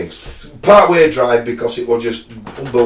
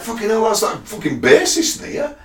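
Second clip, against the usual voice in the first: excited retold exclamation; 11 semitones higher than usual. Spotting the band's bassist.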